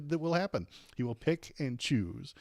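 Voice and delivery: ordinary delivery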